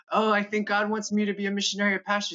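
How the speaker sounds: high, sweet mock-innocent voice; hopeful younger self. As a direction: play the eager young believer. Retold plea to dad.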